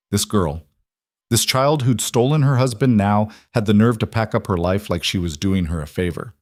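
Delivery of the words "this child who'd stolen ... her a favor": ordinary delivery, nothing marked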